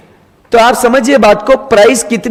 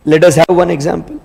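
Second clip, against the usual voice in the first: ordinary talk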